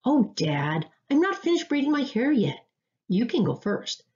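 high, protesting call to Dad; lightly shouted teen-style protest from another room; shouting back. A narrator's reading. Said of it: "Trina shouted from back in her room".

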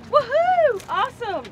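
excited whoop. Real, not acted. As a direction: cheer the catch at the top of your voice. First snook of the day.

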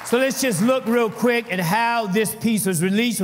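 loud rallying call to praise; preaching at full force. Acted. Preacher urging the congregation to clap, loud rallying call.

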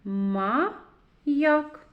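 Маяк split into МА, pause, ЯК; syllable by syllable. Shown as a demonstration. Teacher reads with children, syllable by syllable. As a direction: read Маяк in two separated syllables, Ма – як. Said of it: "Прочитаємо".

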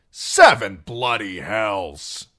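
slow, loud angry oath; snapped. A narrator's reading. Singer snapping at the sobbing wildling girl, angry. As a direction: bite the curse off in anger. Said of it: "Darion snapped".

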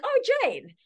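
calling out to a friend across the gym, high and eager; eager greeting. Retold or imitated. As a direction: call out to her, pleased to see her.

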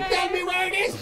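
high-pitched mother's demand; mock motherly yell; yelling mom. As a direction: order it out of the child. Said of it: "Two and a half hours of yelling".

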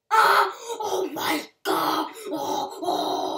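loud joyful yelling; excited shout. Real, not acted. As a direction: yell out in delight. Marker lands, excited outburst.

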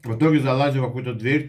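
chewing between words; eating while telling story; mouth full while talking. Real, not acted. Seen 4: Bite at -2.0; cheek bulge and fork in hand while speaking.

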